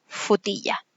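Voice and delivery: put-on soft futilla, the child dropping the r; child's cluster reduction. Shown as a demonstration. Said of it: "no la dice y solo reduce esta sílaba a fu".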